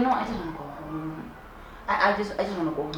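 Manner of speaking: soft, quiet wish to leave; small plea. Retold or imitated. Telling the guy she wants to go home, quiet and small. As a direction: murmur it, wanting out.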